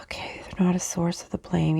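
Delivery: soft unvoiced whisper. Real, not acted. Whispering the spirits' message about blame. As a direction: whisper it to yourself.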